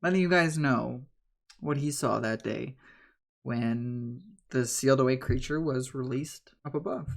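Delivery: ordinary talk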